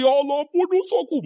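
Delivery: high-pitched tearful plea; mimicking a man sobbing for forgiveness; imitated crying. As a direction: plead high, as if weeping. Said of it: "Menangis dia".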